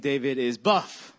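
"buff" punched at the end; stress on BUFF. Shown as a demonstration. Pastor showing congregation the syllable game, stress on "buff". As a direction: land hard on "buff". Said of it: "you emphasize every syllable".

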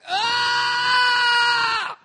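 high piercing mock scream at full voice; imitated scream. Pastor reenacting the girl's scream to the church, high loud shriek. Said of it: "she just started screaming at the top of her lungs".

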